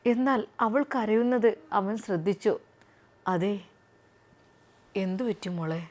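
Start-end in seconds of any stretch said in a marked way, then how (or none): 3.2-5.8 lightly voiced gentle husband, lower and softer; husband gently asks his crying wife what's wrong; doctor husband. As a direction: comfort her as the soft doctor.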